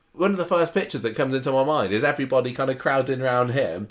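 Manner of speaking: normal talking voice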